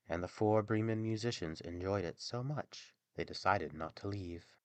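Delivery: usual speaking voice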